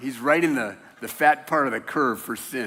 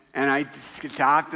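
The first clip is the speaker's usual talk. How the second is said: ordinary delivery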